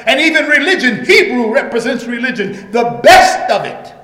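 loud preacher's cry, punching each phrase; full-force preaching. Real, not acted. Preacher proclaiming Christ unchanged to the congregation, full-force preaching.

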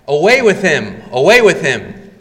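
loud chant of an angry mob; preacher voicing the crowd's furious demand; crowd yelling. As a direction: shout it like a baying crowd. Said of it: "the crowd yells back".

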